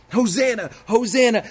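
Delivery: high, loud, excited cry of hosanna; crowd shouting praise. Acted. Preacher voicing the Palm Sunday crowd, excited loud cries. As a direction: call out hosanna like the festive crowd.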